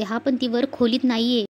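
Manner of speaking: plain reading voice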